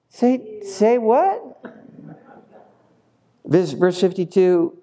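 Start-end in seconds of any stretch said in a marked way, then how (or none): 0.1-1.4 high-pitched double take; comically stunned, rising high; mock disbelief. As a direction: squeak it as a baffled question. Acted listeners shocked at flesh line.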